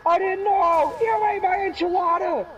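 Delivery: very high, loud manic yelling; hammed-up hysterical shouting; Crazy Steve. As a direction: imitate a sitcom freakout at full pitch. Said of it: "Crazy Steve moment".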